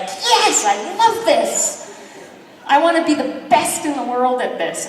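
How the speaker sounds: high, eager gush; bubbling over with newbie enthusiasm; gushing, wide-eyed beginner; gushing excitement. Retold or imitated. Excited newbie after first swim.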